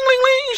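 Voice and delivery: high sung bell chime; imitated doorbell. Recalling the store bell when he opened the door, bell imitation. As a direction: ring out like a little shop bell. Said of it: "you heard that cling".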